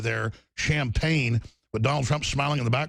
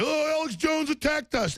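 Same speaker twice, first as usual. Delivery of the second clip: mocking whine; 12 semitones higher than usual. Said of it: "They're like".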